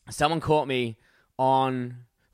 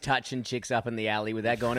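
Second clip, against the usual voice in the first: usual speaking voice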